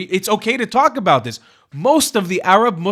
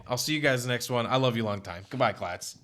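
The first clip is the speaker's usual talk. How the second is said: usual speaking voice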